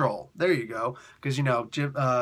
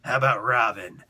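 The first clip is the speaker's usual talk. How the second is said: low, gravel-free boss voice; gruff suggestion; 6 semitones lower, 3 dB louder than usual. Retold or imitated. Boss suggesting Robin, low boss voice.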